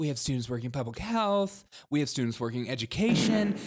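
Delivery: "health" and "education" pushed upward like questions; mocking uptalk. Host parrots the interviewee's list of students, mocking uptalk. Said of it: "we need to get rid of Uptalk now".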